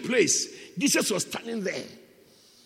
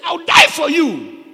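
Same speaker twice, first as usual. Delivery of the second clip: high, loud, drawn-out declaration; slow, ringing shout; Peter's bold vow; 7 semitones higher, 12 dB louder, slower than usual. Acted. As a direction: proclaim it as a passionate vow. Acts out Peter's vow to Jesus.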